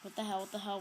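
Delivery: ordinary talk, nothing marked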